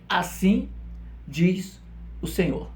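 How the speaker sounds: slow, spaced-out prophet's pronouncement; prophetic proclamation. Retold or imitated. Preacher quoting Jeremiah to the congregation, slow measured proclamation. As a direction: proclaim it word by word, with pauses. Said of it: "Jeremias falava também".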